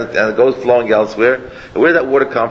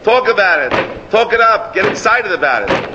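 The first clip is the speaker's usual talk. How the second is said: higher, eager urging to get excited; excited exhortation; 8 semitones higher than usual. Retold or imitated. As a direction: pump the listeners up like a rabbi. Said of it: "He says".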